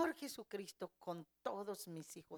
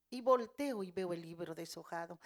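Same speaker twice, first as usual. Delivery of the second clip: ordinary talk, nothing marked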